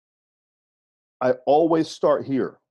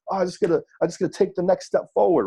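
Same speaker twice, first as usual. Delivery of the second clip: upbeat wrestler; 5 semitones higher than usual. Retold or imitated. Wrestler now confident.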